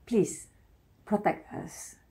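whispered, pleading softly; hushed heartfelt request; soft plea. Real, not acted. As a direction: beg gently, almost breathless. Pleading to men.

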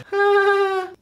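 very high, sped-up cartoon giggle; a chipmunk-style giggle; squeaky high laugh.

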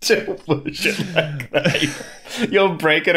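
playful high mimicry of a partner's line; mock repeat. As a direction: parrot it back high and slow, teasing.

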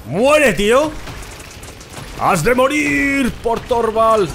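real shouting in the heat of the game; gamer battle yell. Real, not acted. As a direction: roar at the enemy. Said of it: "Igual estoy gritando".

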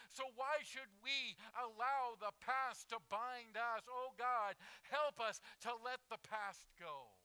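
loud, high, drawn-out preacher's cry; full-force preaching. Real, not acted. Preacher proclaims freedom from the past, full-force preaching. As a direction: proclaim it at the top of the voice, stretching the key words.

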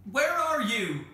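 high, loud drawn-out hail; shouting. A narrator's reading. Andrew shouts up to the unseen callers, loud hail. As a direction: holler the question across a distance. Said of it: "shouted Andrew".

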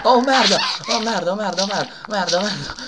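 explosive italian cursing. Real, not acted. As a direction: curse loudly in sheer fright. Panic as monster appears.

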